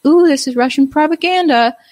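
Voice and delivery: hamming up a prissy alert voice; mock warning. Mocking Facebook's propaganda warning, high and singsong. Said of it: "you do get a message like".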